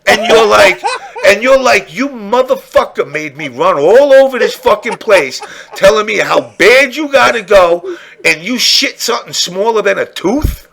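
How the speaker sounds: high, laughing mock outrage; laughing, indignant rant; laughing disbelief. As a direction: rant at the stomach through laughter.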